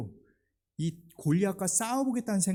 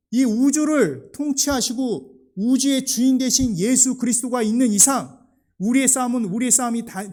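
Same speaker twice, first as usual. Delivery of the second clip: loud, high, driving sermon climax; preaching at full force; 6 semitones higher, 9 dB louder than usual. Acted. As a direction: proclaim it to the whole church, louder and higher. (힘차게 외치는 설교)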